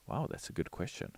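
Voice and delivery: breathy whisper of mock awe; a soft whispered wow; whispered aside. Real, not acted. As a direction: hush it like a secret. Whispered aside after question.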